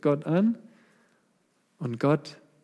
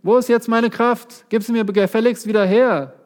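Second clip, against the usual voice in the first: demanding; 7 semitones higher, 5 dB louder than usual. Acted. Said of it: "Er hat nicht gesagt".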